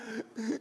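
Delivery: soft quick gasp for air; sharp gasp. Retold or imitated. Retelling a panic attack in the stairwell, a soft gasp. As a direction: gasp as if the breath won't come.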